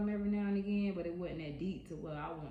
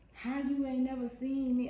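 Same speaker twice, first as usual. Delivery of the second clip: high, louder disbelieving challenge; indignant; 7 semitones higher, 6 dB louder than usual. Retold or imitated. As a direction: demand an answer, incredulous. Wife confronting teen at door.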